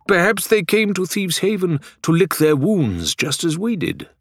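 a hint of a foreign gentleman; lightly voiced Faisal. A narrator's reading.

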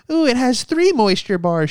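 high, prissy mocking voice, slowed; pampered shaver. Comic mocking modern men cooing over fancy razors, high and prissy. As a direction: camp it up like a spoiled man at his shave.

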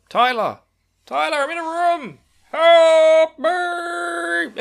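high, loud mock panic shout; hammed-up desperate cry; yelling for help.

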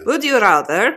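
a hint of a put-on witch accent; half-hearted sinister witch accent asking a game question; Grand High Witch. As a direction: lightly voice a villainous witch. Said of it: "you're doing a bloody accent".

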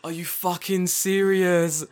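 high, slow, delighted disbelief; amused disbelief. Real, not acted. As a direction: draw it out, amazed and amused.

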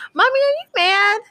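put-on high-pitched little-kid questioning; little boy. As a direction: imitate a small son checking on mommy. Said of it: "sometimes he be like".

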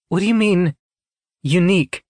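high-pitched, uneasy questioning; wary question. A narrator's reading. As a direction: ask it high, suddenly on guard. Citra alarmed by the hint.

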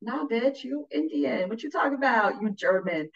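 dismissive dad. Retold or imitated. Dad scoffing at his daughter's claim, mock dismissive. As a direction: brush her off like dad. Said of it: "And my dad's like".

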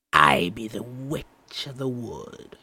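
put-on slow, far-off child faking a crone; a child pretending to be an old witch, slowly; old scratchy voice. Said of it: "pretending to be old and scratchy".

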